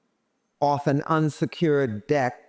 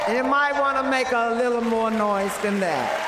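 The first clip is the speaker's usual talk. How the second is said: sassy diva; 7 semitones higher than usual. Real, not acted. Diva teasing the audience.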